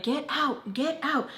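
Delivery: high, sharp mock yell of get out; imitated yell through the bathroom door; mock angry yelling. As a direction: bark get out like an annoyed aunt. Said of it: "pounding on the door, like".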